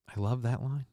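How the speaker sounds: ordinary delivery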